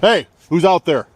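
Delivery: loud, high call into the night; calling into the dark. Real, not acted. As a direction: shout out into the darkness.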